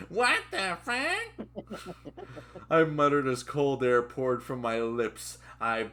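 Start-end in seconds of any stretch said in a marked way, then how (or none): 0.1-2.0 very high, extremely drawn-out squeal; character's put-on voice. Reader showing the character's voice, slow high squeal. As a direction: stretch it slowly in a comic high voice. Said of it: "That's his voice".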